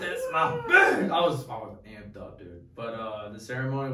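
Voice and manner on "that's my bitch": very high, loud, stretched-out squeal; squeaky mock blubbering. Groom showing off his bride, loud slow mock squeal. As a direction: draw it out in a squealed falsetto.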